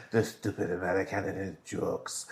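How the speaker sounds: put-on soft laughing French accent; mock French waiter under laughter. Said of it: "That's my French accent".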